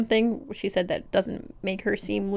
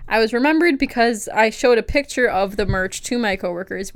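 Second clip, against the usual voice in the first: ordinary talk, nothing marked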